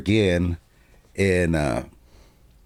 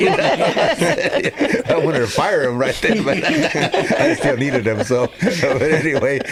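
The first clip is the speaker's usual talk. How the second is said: higher, slow words broken by laughter; laughing; 7 semitones higher, 3 dB louder, slower than usual. Real, not acted. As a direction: laugh through the punchline. Husband laughing at his own joke.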